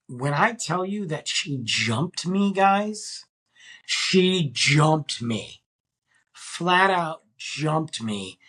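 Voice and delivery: slow right down and lean on "jumped"; emphatic storytelling. Real, not acted. Telling listeners she jumped him, slow emphatic disbelief on "jumped".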